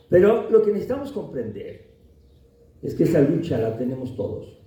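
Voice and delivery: ordinary delivery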